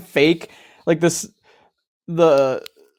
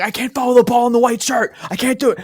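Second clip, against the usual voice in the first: high, raised imitating yell; mock yell; 8 semitones higher, faster than usual. Sweaty player screaming at his opponent, mock yell. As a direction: belt out the complaint, retold. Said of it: "they're, like, screaming at each other".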